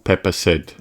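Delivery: plain reading voice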